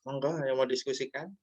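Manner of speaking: ordinary delivery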